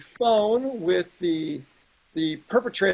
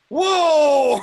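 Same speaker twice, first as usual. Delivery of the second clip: higher, louder, slow stretched whoa; amazed whoa; 8 semitones higher, 10 dB louder than usual. Real, not acted. Telling the hosts how his ex reacted, amazed and stretched out. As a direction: let the surprise stretch out loud. Reacting to ex-wife's mild reply.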